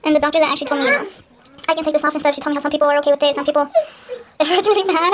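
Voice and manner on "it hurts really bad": laugh-crying in pain. Real, not acted. Vlogger feeling the tug, laughing through pain. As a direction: giggle and whimper at once. Pulling hairs out of skin.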